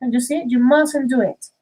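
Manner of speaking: normal talking voice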